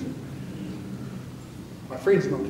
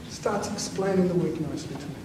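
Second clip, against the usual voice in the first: ordinary talk, nothing marked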